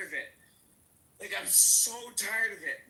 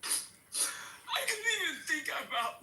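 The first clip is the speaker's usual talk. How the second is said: slow, pitched up, choked with tears and a wet laugh; words squeezed out through crying; emotional; 7 semitones higher, slower than usual. Real, not acted. Guest picturing a threat to his family, breaking down in tears. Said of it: "I'm sorry for getting emotional".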